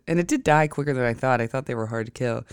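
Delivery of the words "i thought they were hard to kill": ordinary talk, nothing marked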